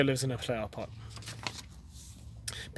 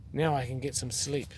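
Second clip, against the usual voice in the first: thought happily; 3 semitones lower, 9 dB quieter than usual. A narrator's reading. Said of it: "He thought happily".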